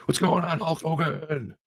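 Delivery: high, pumped-up wrestler hype; mock Hulk Hogan greeting. Host needling Hulk Hogan in a hotel lounge, mock wrestler hype. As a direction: ham it up like a wrestling promo. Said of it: "I'm like".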